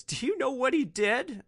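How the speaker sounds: high-pitched mock tearful question; imitating crying outburst. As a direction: retell her breakdown, pitch jumped way up. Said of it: "causes her to break down and start crying".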